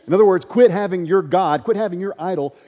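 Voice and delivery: loud, driving sermon voice; fiery preaching. Acted. Preacher pressing the congregation to quit idols, fiery. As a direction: hammer each quit home.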